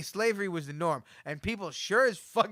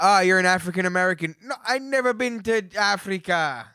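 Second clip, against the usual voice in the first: put-on Jamaican accent; 4 semitones higher, 9 dB louder than usual. Teasing his co-host, loud in a comic Jamaican accent. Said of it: "That was my own Jamaican accent".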